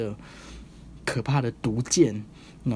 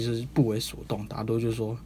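usual speaking voice

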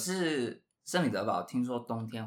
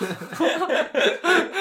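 squealing high laugh; a burst of shrill giggles; high cracking laugh; 9 dB louder than usual. Real, not acted. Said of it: "我认同你的话".

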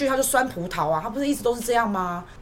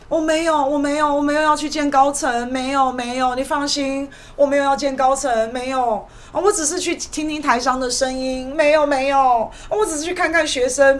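higher, louder flustered excuses; mock nervous denial; 4 semitones higher, 3 dB louder than usual. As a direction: parody a jittery official backpedaling. Mocking KMT's nervous denials.

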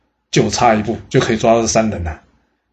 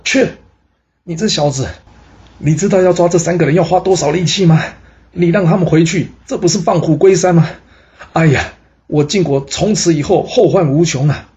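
furious rebuke; 6 semitones higher than usual. A narrator's reading. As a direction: spit out the words in a rage, voice climbing. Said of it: "气急败坏的呢". (气急败坏地怒骂)

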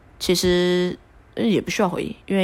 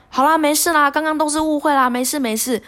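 higher, lightly voiced reassuring coach; coach soothing; 9 semitones higher than usual. Instructor calming a crying passenger, higher soothing voice. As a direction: play the coach calming her down. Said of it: "他就说".

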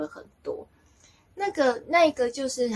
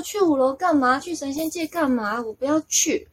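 higher, louder irritated pushback; annoyed refusal; 6 semitones higher, 3 dB louder than usual. Retold or imitated. Refusing the subconscious's invitation to the fifth floor, irritated. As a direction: retell a testy refusal, pitched up. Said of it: "我就觉得很烦啊".

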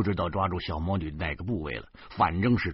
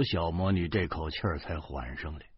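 ordinary delivery, nothing marked

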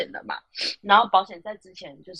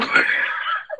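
high unvoiced laugh bursting out; can't hold in the laugh; burst of laughter. Real, not acted. Laugh at customer's name. (爆笑出声)

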